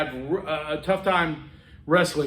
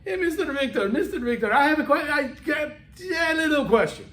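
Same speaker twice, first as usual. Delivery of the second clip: put-on higher, halting Romanian-accented English; 7 semitones higher than usual. Romanian captain asking his boss a question, Romanian accent. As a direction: imitate a hesitant Romanian asking a favor. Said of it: "this Romanian captain sitting next to me goes".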